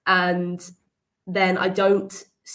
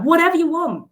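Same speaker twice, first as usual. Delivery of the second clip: dismissive casting director; 10 semitones higher, 6 dB louder than usual. Retold or imitated. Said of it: "they're like".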